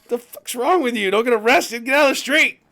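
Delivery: high, loud frantic scolding; husband shouting at wife Jill, high and loud; panicked yell at wife. Retold or imitated. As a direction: yell at Jill to get out of the street. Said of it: "I'm like".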